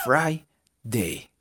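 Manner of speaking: "Friday" broken into "frai - dei"; "ay" sound. Shown as a demonstration. Teacher modelling for learners, "Friday" as "frai-dei". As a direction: stretch apart "Friday", with ai like ay. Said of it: "Como ay".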